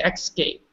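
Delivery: escape said EK-skape, with the x; imitated mispronunciation. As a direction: echo the student's "excape". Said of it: "I heard the X".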